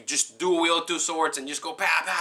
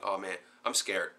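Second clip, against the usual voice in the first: mock scared; 5 semitones lower, 7 dB quieter than usual. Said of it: "I'm like".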